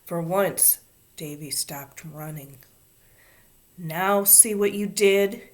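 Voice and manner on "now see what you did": louder, slow, weighted reproach; accusing. A narrator's reading. Davy blaming Ramona for his shirt, accusing. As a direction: lay the blame, stressing each word. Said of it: "he accused".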